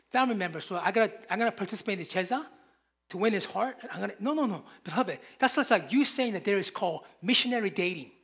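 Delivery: ordinary talk, nothing marked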